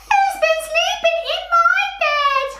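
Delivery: tiny, very high squeaky bear voice; extremely high little storybook bear. Acted. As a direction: squeal it out right at the top of the voice. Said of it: "not only people's voices that make high and low sounds".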